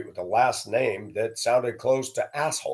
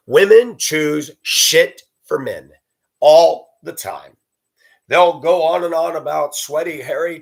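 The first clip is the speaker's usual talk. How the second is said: much louder and slow; emphatic rant; 4 semitones higher, 8 dB louder than usual. Real, not acted. Ranting about women, loud and slow. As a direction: pound each word out.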